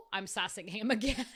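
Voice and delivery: usual speaking voice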